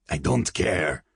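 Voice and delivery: brusque attacker shutting down a plea; dismissive. A narrator's reading. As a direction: cut in with cold impatience. Attacker cuts off her plea.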